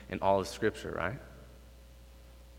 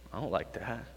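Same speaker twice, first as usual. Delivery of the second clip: quiet confession; 8 dB quieter than usual. Real, not acted. Confession, dropped low.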